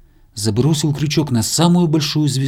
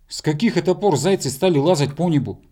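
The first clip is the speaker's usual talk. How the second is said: raised-pitch mild grumble; grumbling bear cub; 5 semitones higher than usual. A narrator's reading. Bear cub tugging the rope, higher-pitched grumbling. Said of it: "проворчал медвежонок".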